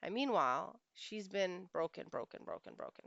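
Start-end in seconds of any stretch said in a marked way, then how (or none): 1.7-3.1 hushed repeats sinking into near whisper; fading sorrowfully. Real, not acted. Coach on a worn-down wife, sorrowful fade. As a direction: let the word die away, lower and softer.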